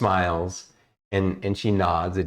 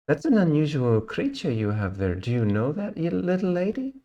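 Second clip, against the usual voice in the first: put-on higher, sweet, reserved young elf; elf ranger voice; 9 semitones higher than usual. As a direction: speak as a sweet shy elven ranger, a little higher. Elf ranger talks to the girl.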